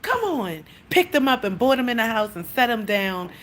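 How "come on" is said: mock scolding. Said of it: "act like I was mad at my cat".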